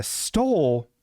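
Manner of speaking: stole stretched very slow and falling; drawn-out stole. Shown as a demonstration. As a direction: drag out stole with a fall. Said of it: "you know what really stole".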